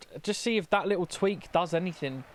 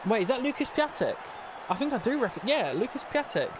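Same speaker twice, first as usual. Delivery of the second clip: higher, sudden pitch jump of recognition; surprised recognition; 7 semitones higher than usual. Real, not acted. Manager spotting an opposing player, surprised. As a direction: catch sight of him and react.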